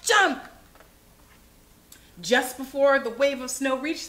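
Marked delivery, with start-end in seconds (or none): 0.0-0.4 loud, high one-word command; explosive storybook shout; urgent cry. A narrator's reading. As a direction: shout it as a sudden order. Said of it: "cried Grandpa".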